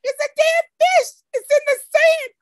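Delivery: put-on be the kid pointing and demanding answers; girl insisting to grandmother about the dead fish, high and frantic; upset little girl. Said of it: "And I said".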